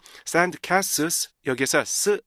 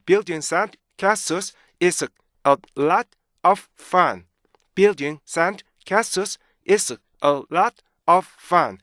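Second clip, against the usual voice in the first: very slow, higher, punched chunks; broken word by word; 4 semitones higher, 4 dB louder, much slower than usual. Shown as a demonstration. Teacher showing original breaks, slow staccato reading. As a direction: stop cleanly after every chunk. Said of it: "원래 이렇게 끊어진 거예요".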